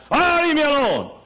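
high, loud brush-off; acted irritated outburst. Drunkard rebuffing his pleading sister, high exasperated shout. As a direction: holler it to shake someone off.

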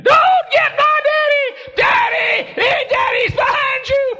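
very high, loud mock scream; imitated child's screaming. Becky warning her daddy of Silly Billy, screaming. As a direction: shriek a warning like a panicked little girl. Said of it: "I can recall Becky screaming".

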